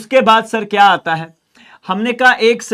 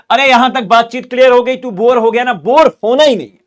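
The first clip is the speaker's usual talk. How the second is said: louder, higher, fast pep talk; rousing teacher; 5 semitones higher, 7 dB louder, faster than usual. Real, not acted. Teacher rousing a student who seems bored, loud and fast. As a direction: wake up the bored class.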